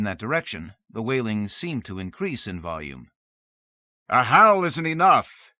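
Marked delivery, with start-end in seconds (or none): 4.1-5.5 a loud, high call into the dark hall; much louder, demanding cry. A narrator's reading. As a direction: call out to the wailing spirit. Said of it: "he cried".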